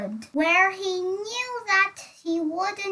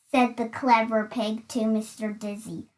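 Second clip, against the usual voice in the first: ordinary delivery, nothing marked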